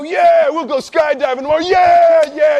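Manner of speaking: put-on high loud drunken yeah, laughing; drunken cheer. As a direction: whoop it up like a tipsy crowd. Drunk friends cheering agreement.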